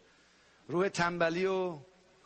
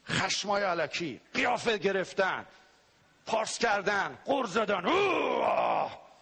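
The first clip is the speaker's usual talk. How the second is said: mock angry outbursts; 2 semitones higher, slower than usual. Preacher acting out angry spirit behaviors.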